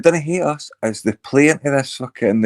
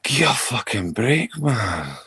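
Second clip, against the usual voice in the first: mock twangy Scottish accent; 5 dB quieter, slower than usual. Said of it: "put on a weird twangy Scottish accent".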